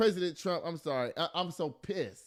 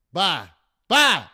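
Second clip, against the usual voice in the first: shouted goodbye; 2 semitones higher, 13 dB louder than usual. Real, not acted. Host fed up with the news, yelling bye. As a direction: yell bye as if walking out in disgust. Mock storming off the show.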